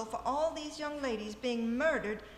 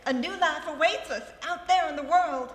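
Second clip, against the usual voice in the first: gushing joy; 4 semitones higher, 10 dB louder than usual. Acted. Hilda gushing over leaving.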